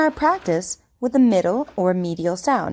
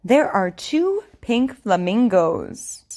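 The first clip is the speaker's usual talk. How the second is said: over enunciating. Shown as a demonstration. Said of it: "I want you to repeat after me".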